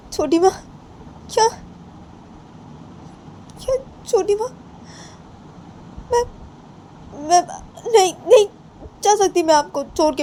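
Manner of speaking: ऊँची आवाज़ में रोती-सी लड़की; upset young girl. Acted. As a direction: beg not to be sent away, voice high and strained. Bani refusing to leave, distressed.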